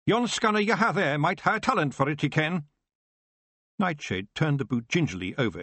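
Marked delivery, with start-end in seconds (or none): 0.0-2.6 high, brisk Scottish brogue; a wee Scottish pictsie boasting; Scottish Feagle voice, raised pitch; Scots pixie. Acted.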